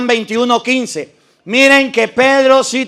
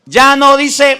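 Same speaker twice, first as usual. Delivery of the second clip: usual speaking voice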